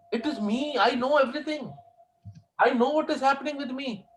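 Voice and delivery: defiant seeker. Acted. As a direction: play the proud one who refuses advice, pitch up.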